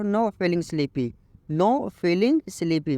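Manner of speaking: very slow, each English word laid out clearly; slow teaching read. Shown as a demonstration. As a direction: model the sentence slowly for learners. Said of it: "आप इसको English में बोलो".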